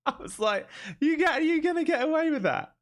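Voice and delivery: high, squeaky-pitched words through real laughter; laughing hard. Real, not acted. Streamer cracks up retelling the ear comment, laughing high. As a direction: barely get it out for laughing.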